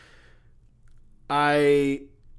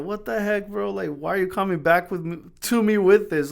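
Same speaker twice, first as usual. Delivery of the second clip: higher, indignant what the heck; exasperated disbelief; 6 semitones higher than usual. Retold or imitated. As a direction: throw it back at the nephew in disbelief. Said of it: "And I was just like".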